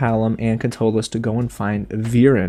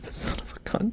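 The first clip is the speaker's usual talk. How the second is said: whispered; 10 semitones higher, 11 dB quieter than usual. Real, not acted. Stunned reaction before reading the letter.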